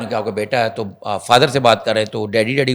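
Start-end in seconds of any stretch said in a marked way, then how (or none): none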